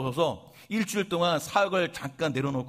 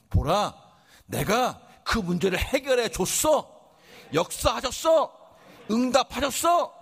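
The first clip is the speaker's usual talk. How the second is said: rising proclamation, higher and louder, ending in a shout; voice of the Lord; 7 semitones higher, 3 dB louder, slower than usual. Acted. Preacher voicing the Lord speaking to the congregation, climbing to a shout. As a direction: build it up like God announcing victory. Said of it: "이렇게 말씀하실 거예요".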